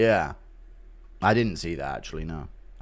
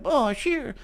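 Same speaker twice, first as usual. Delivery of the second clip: very high, meek cartoon boy; aw-shucks falsetto child; Butters; 13 semitones higher, 3 dB quieter, slower than usual. Shown as a demonstration. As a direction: hesitate in a tiny high-pitched kid voice. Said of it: "Butters is a".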